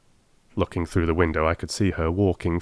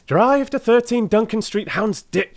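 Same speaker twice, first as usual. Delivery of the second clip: high, loud call to the cabman; 4 dB louder than usual. Retold or imitated. Old woman calls her address to the driver, high and loud. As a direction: sing it out across the street. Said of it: "she cried".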